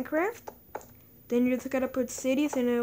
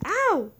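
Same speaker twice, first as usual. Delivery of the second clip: sharp high ow; pained yelp; 7 semitones higher, 6 dB louder than usual. Real, not acted. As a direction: cry out in sudden pain. Being hit by mobs.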